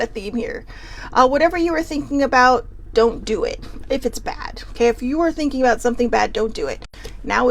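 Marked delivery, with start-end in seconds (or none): none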